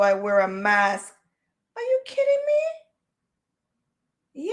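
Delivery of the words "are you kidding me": sudden high cry of disbelief; shocked disbelief. Real, not acted. Reacting to the heat, a high shocked cry. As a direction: squeal it out, truly stunned.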